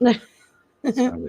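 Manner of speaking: high, slow and sing-song; a high mocking squeal, drawn out; mock squeaky voice. Host mocking the statement in a high slow voice.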